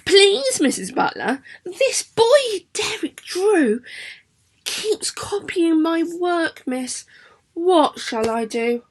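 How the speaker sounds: put-on child's voice, high and slow with a pleading rise. Shown as a demonstration. Teacher reads the poem for the class at home: a slow pleading child. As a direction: beg the teacher slowly, like a worried pupil. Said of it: "So, I'm gonna do it for you".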